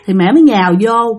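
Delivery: ordinary delivery, nothing marked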